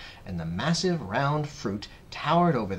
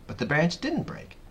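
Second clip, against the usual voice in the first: plain reading voice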